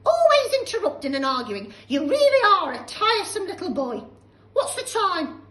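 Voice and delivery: put-on shrill, loud scolding old woman; cranky old grandma. Grandma scolding George, loud and high. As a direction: snap at the boy like a nasty old hag. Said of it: "she cried".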